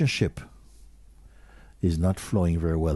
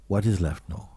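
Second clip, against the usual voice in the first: ordinary talk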